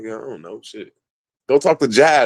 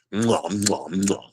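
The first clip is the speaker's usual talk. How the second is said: kiss sounds; 3 semitones lower, 7 dB quieter, slower than usual. Pretend. Mock kisses.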